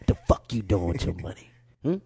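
Dick Gregory impression. Comedian retelling his elder grilling him about money, low and gruff. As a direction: drop way down and lean on him like a stern elder.

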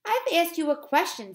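loud, high, indignant teacher; stern demand. A narrator's reading. Teacher to children ignoring her question, stern demand. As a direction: scold children who are ignoring you.

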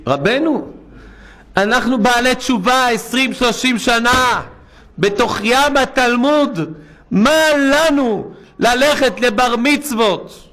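a penitent asks the rabbi why he may not go; pleading questioner. Retold or imitated. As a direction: voice him as a pious man asking for permission. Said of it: "אמר לי אחד השבוע".

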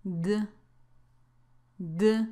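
"D" as the bare consonant sound, not the letter name; a short, low voiced "D" sound; ed ending sound. Shown as a demonstration. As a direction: model just the sound of "D". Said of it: "se pronuncia así".

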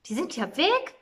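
high, loud exclamation; astonished cry. A narrator's reading. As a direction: call out in amazement. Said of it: "rief sie". (erstaunt ausgerufen, hoch und laut)

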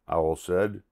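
plain reading voice

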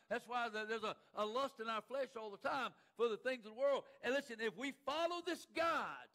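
loud, driving sermon, building in pitch; forceful preaching. Real, not acted. Preacher warning congregation about the flesh, building forceful preaching. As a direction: build from insistence to a high, loud peak.

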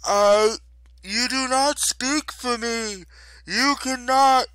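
loud, high, slow wail of defiance; furious protest. A narrator's reading. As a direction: bellow as a goddess fighting the spell. Goddess protests against being lulled.

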